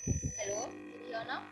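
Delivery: faint voice, far from the mic; speaking too far from the mic; barely audible. Real, not acted. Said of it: "No, it's very low".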